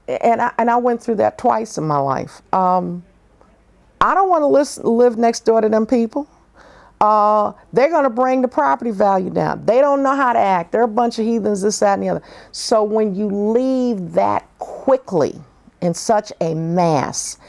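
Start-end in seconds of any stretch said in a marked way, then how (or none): 4.0-12.2 higher, indignant mock complaint; mocking bigoted neighbor. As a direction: ham up the outraged neighbor.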